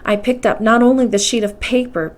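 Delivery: ordinary delivery, nothing marked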